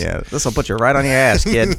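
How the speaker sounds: tough grown-up. Pretend. Adult handing out cigars to a kid, mock tough warning. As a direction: ham up a seasoned drinker talking to a child.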